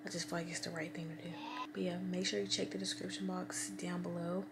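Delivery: whispering. Real, not acted. Signing off in bed as both boys sleep, whispering. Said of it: "I don't even know why I'm whispering".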